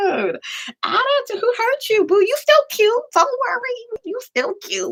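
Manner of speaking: very high, cooing mock comfort; obnoxious mocking. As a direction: coo at him like a sulky child. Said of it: "We were, like, obnoxious like that".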